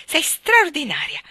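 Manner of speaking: loud, joyful "Oh" then a high, slow "fantastica"; delighted cry. A narrator's reading. Mary thrilled by Marta's plan, crying out. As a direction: call it out with pure delight. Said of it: "gridò Mary".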